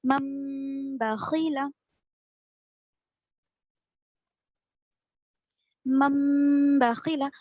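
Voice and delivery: मम बखीला with the मम held long through the nose; nasal ghunna demo. Shown as a demonstration. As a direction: stretch मम as a long nasal hum before बखीला. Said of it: "नाक में ले जाकर आवाज को गुन्ना करते हुए". (मम को नाक से गुन्ना करके लंबा खींचें)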